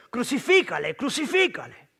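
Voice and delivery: high-pitched cry of an angry mob; crowd shouting. A narrator's reading. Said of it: "dieron voces diciendo". (gritos de la multitud)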